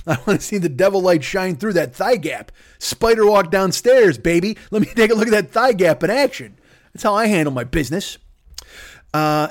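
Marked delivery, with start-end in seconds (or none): 2.8-6.6 mock excited. Eager lecherous cheering.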